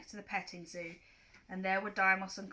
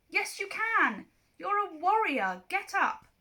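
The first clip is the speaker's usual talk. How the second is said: higher, louder, slow rallying call; urgent encouragement; 10 semitones higher, 7 dB louder, slower than usual. Acted. Percy rallies fallen Clarice, high slow urgent call. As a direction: pull her up with your voice.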